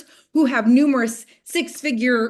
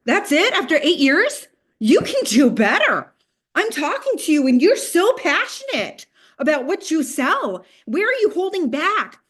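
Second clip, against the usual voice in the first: higher, louder, pressing challenge; pushy coach challenge; 7 semitones higher, 5 dB louder than usual. Retold or imitated. Nam challenging her at the party, higher and insistent. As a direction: push her hard, like a mentor calling her out. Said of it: "He's like".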